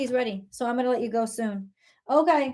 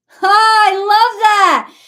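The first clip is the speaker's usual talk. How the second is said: loud, high, stretched-out joy; delighted shout; 8 semitones higher, 12 dB louder, much slower than usual. Real, not acted. Reader thrilled by the card, shouting with joy. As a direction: burst out with delight at the answer. Delighted reaction to tarot answer.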